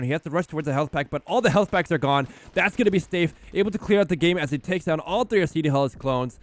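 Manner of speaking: rising excited play-by-play call. Real, not acted. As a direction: build it up as the win lands. Caster calls the game-winning play.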